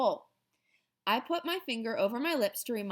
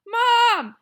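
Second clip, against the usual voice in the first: much higher, very loud call for mom; yelling across the apartment for help; loud high alarmed call; hollering; 13 semitones higher, 13 dB louder than usual. Acted. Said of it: "I hollered".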